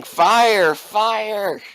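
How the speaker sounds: high, drawn-out shouted command; acted shout. Game master as Thomas ordering you to shoot, shouting. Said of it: "he is shouting".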